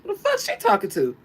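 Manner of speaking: high, loud, fast outburst. Retold or imitated. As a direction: blurt it out offended and quick. [name] outraged retold.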